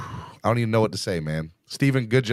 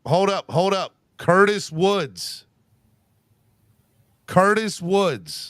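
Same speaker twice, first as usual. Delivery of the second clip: excited; 7 semitones higher, 4 dB louder, much slower than usual. Real, not acted. Host interrupts to announce donation.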